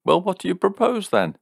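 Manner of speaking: quick, high-pitched demand. A narrator's reading. Man demanding her proposal, fast and high. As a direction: fire it back fast and sharp. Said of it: "he demanded".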